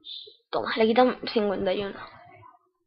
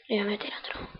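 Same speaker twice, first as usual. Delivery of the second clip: whispering; 10 dB quieter than usual. Real, not acted. Whispered aside while aiming.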